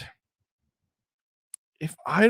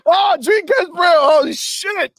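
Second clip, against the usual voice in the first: surprised shout; 11 semitones higher than usual. Real, not acted. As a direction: yell out in surprise. A surprise visitor appears.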